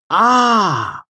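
high, loud mock-disappointed ah; feigned disappointment. A narrator's reading. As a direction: sigh out fake regret. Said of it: "feigning disappointment".

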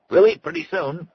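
announcing. A narrator's reading. Host calls guests to dinner, announcing. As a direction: call it out high to the whole room. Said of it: "he announced".

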